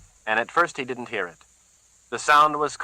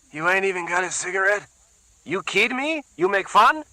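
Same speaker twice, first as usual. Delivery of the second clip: a higher, mocking Puerto Rican accent; 11 semitones higher than usual. A narrator's reading. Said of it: "less pronounced accent than the other".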